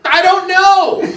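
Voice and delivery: high, loud mock wail; mock yelling. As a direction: imitate a helpless, panicked suspect. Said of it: "he's yelling".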